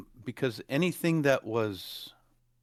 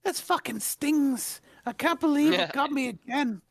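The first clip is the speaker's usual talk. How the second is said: high whiny voice; 3 dB louder than usual. Retold or imitated. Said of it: "He's like".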